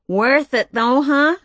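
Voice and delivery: slow, drawn-out sassy colleague voice; brassy V. Acted. Brash reporter V teasing the new girl, sassy. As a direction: tease the newcomer with a worldly drawl.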